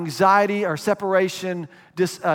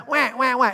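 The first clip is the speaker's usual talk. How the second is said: high playful wah wah wah; hungry baby's mock wail; mock baby crying; 6 semitones higher than usual. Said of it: "We cry".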